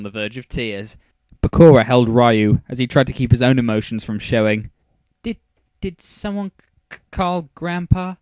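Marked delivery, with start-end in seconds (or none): 5.2-8.2 higher, halting and stammering; shaky voice. A narrator's reading. Heiba asking friends about Grandpa, shaky stammer. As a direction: stumble over the words, pitched up and timid. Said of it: "Heiba asked in a shaky voice".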